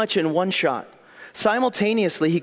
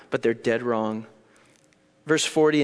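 ordinary talk, nothing marked

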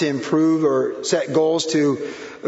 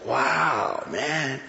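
mock awe; 4 dB quieter, much slower than usual. Awed at Bel Air mansions.

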